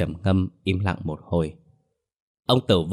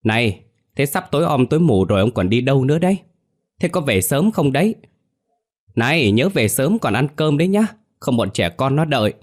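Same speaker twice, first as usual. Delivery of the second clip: higher, louder hurried call; calling after someone; 6 semitones higher, 4 dB louder than usual. A narrator's reading. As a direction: call out after a departing husband. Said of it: "Bà Miên vội vã gọi với theo sau lưng". (vội vã gọi với theo sau lưng)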